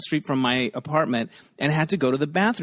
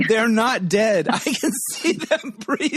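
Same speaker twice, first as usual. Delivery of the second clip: put-on drunk heckler yell; 7 semitones higher than usual. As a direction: call it out like a tipsy woman in the front row. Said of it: "in the front row yelled".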